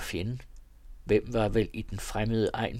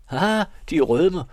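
delighted surprise; 8 semitones higher, 8 dB louder, slower than usual. Acted. Narrator teases blushing Mathilde.

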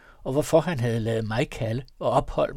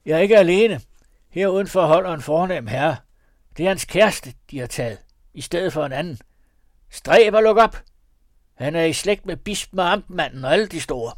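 higher, louder threatening call; bully them into opening; shouting; 5 semitones higher, 7 dB louder than usual. A narrator's reading. Robber woman shouting at the house, loud.

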